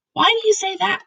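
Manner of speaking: put-on a curious little girl asking; Sophie's voice. A narrator's reading. Sophie asks.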